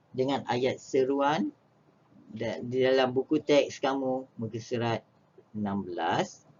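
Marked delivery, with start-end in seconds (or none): none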